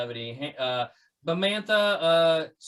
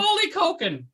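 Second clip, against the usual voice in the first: screaming macaw; 12 semitones higher than usual. Pretend. Host playing a Pokemon macaw screaming its name, high squawk. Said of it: "macaw would probably scream its friggin' head off".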